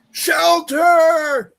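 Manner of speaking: high, loud bellow; yelled request. Retold or imitated. Fan calling a request to the band on stage, yelled out. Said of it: "And I yelled out".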